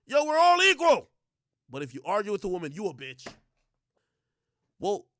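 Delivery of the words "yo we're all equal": loud, high-pitched parody of a slogan; mocking proclamation. Women claiming equality, mocking shout. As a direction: shout it out in mockery.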